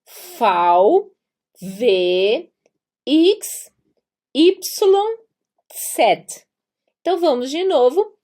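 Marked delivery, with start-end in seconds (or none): 0.0-6.3 letters one by one, drawn out, paused; alphabet recitation. Shown as a demonstration. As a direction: recite the German alphabet for learners to repeat. (recitando o alfabeto alemão, letra por letra)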